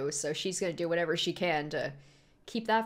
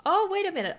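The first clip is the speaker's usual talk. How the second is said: surprised realization; 11 semitones higher, 5 dB louder, faster than usual. Real, not acted. Sudden realization watching episode.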